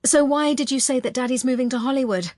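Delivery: tense parent trying not to snap; losing her cool. A narrator's reading. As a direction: press a child for an answer, composure slipping. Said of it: "I'm losing my cool".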